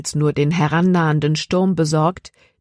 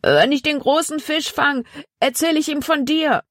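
high, drawn-up distance call; high-pitched calling voice; calling back; 10 semitones higher than usual. A narrator's reading. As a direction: shout back to a friend behind. Zack calls back while fleeing.

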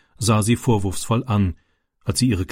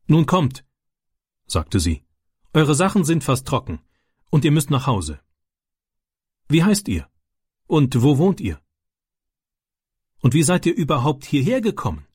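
mother's voice; 5 semitones higher than usual. A narrator's reading. Farm mother questions wet boys.

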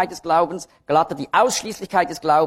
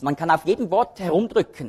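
ordinary delivery

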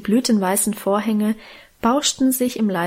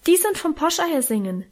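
put-on eager, helpful child piping up higher; helpful call; 6 semitones higher than usual. A narrator's reading. Child jumps in to explain the eggs, helpfully. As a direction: chime in helpfully, pitched up. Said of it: "rief ich hilfsbereit".

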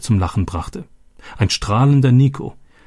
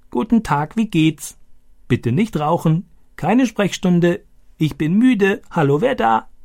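high croaking talking-parrot voice; croaking like Boga; 10 semitones higher than usual. Retold or imitated. Stani shows off the talking parrot's phrases, high croaking parrot. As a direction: squawk each phrase like a pet parrot. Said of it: "Stani krächzte diese Sätze wie Boga".

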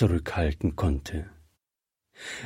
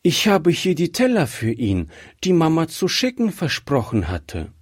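put-on higher, childlike voice; little girl; 6 semitones higher than usual. A narrator's reading. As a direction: voice a polite little girl asking for someone. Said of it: "sagte das Kind". (hohe Stimme eines kleinen Mädchens)

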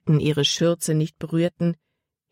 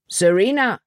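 raised, falling call of a name; calling after someone; 4 semitones higher than usual. A narrator's reading. Paul calls after the girl walking away, calling out. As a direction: call after her, pitched up. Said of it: "rief Paul ihr nach".

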